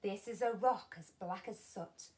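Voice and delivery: usual speaking voice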